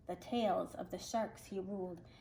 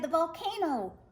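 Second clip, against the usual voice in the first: cried out; 7 semitones higher than usual. Acted. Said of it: "he cried".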